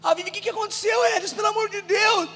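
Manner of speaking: high, wailing cry for family; crying out, re-enacted. Son arriving at his parents' house, crying out.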